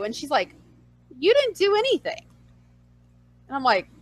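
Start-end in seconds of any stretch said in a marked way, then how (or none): 1.2-2.3 high-pitched retold boss; lightly mimicked boss, high and unconcerned; amused reassurance.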